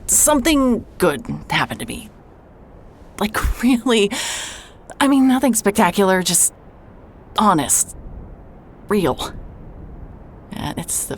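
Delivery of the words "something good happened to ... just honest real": higher, louder, voice straining with feeling; emotional outburst. Acted. Telling the doctor about something good, overwhelmed with emotion. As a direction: pour it out, almost cracking.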